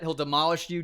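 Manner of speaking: ordinary talk, nothing marked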